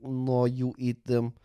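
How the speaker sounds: put-on Russian accent. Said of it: "he's Russian".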